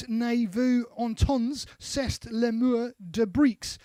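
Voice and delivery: slow, careful French with an English accent; best French reading. Shown as a demonstration. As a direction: read the French slowly, trying your best French. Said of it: "That's my best French for Bastille Day".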